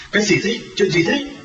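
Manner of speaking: alarmed questioning. Acted. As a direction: rush in demanding to know what happened. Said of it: "chạy lao lên hỏi".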